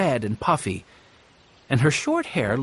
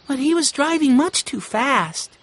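put-on much higher, light girl's voice, puzzled; young girl Violet; 11 semitones higher than usual. A narrator's reading. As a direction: voice shy Violet, a bit uneasy. Violet's voice in narration.

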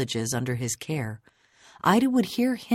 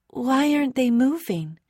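put-on high, louder child voice; young girl's high-pitched question; child's question; 11 semitones higher, 5 dB louder than usual. A narrator's reading. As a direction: ask it high and puzzled like a little girl. Child Ida asks in narrated story.

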